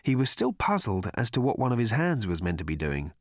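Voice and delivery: usual speaking voice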